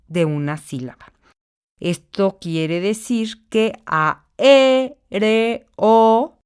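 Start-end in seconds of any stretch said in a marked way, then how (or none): 1.8-6.4 "a, e, re, o" said apart, each syllable raised and louder; split into syllables. Shown as a demonstration. Teacher splitting aéreo into syllables. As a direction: sound out "a, e, re, o" slowly. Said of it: "tiene la sílaba tónica en la e".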